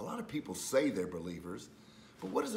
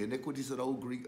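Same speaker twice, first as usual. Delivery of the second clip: ordinary talk, nothing marked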